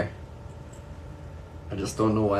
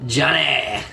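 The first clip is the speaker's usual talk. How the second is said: loud, higher call of his name; 4 semitones higher, 5 dB louder than usual. Real, not acted. Streamer greeting Johnny, calling his name out loud. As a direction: shout the name across the room to greet him.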